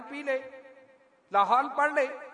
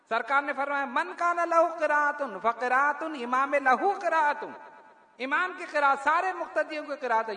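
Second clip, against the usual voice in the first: full-force preaching; 4 semitones higher than usual. Acted. Preacher to congregation proving the imam's reading, preaching. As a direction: drive the point home like a pulpit climax. Preacher builds argument, recites hadith.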